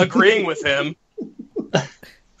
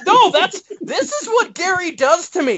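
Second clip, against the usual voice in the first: high-pitched, exasperated rant; shouting; 11 semitones higher than usual. Real, not acted. Complaining about what Gary does to him, shouting. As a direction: protest heatedly with the pitch way up. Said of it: "you're still shouting".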